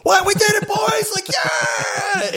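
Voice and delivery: high, whooping re-enactment of a shock win; retold victory cheer. Gamer retelling a last-move win to co-hosts, elated mock shouting. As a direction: shout it high like a team celebrating.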